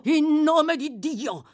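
high, loud, very slow solemn appeal. Acted. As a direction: invoke God gravely. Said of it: "in tono solenne". (solenne e lento)

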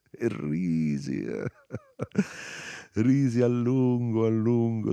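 laughter breaking into high, drawn-out words; bursting into laughter. A narrator's reading. Narrator recounts his uncontrollable fit, laughing. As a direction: tell it through a laugh. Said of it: "Scoppiai a ridere".

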